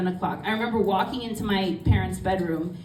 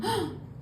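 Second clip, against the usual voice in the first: sharp high gasp of shock; mock gasp; 12 semitones higher, 8 dB quieter than usual. Mom hearing her daughter hit her, high mock gasp. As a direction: gasp as if just betrayed.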